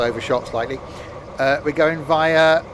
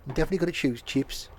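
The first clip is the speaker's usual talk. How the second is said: quicker soft impersonation; voice impression; 6 dB quieter, faster than usual. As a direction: copy his voice closely. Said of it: "There you go, sounded just like him".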